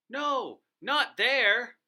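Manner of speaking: high, loud cry of alarm; frantic boss truck shouting no. Acted. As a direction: stop him in panic. Tyrannosaurus sees bad spot.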